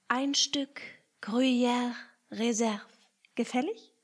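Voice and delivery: lightly voiced, slow and courteous mouse; polite offer. Picondu politely offers cheese to the rat, slow and courteous. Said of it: "fragte er die Ratte höflich".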